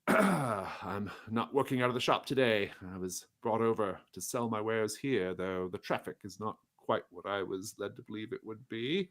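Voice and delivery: mildly put-on merchant complaint; lightly voiced merchant. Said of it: "He says".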